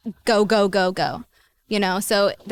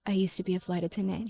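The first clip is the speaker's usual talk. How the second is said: softer, a quiet nervous admission; hushed confession; 8 dB quieter than usual. Retold or imitated. Confessing her past to Peter, hushed and nervous. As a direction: let it out quietly, like a big secret.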